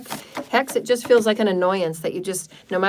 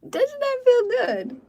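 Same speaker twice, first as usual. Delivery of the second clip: much higher, drawn out and thrilled; delighted squeal; much slower than usual. Real, not acted. Healer asking listeners how they feel, delighted squeal.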